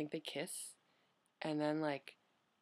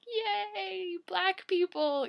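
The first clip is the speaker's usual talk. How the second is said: put-on high-pitched little kid cheer; high, childlike excitement; excited four-year-old; 7 dB louder, much slower than usual. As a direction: cheer it like a delighted preschooler, drawn out and high. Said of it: "Four-year-old me is like".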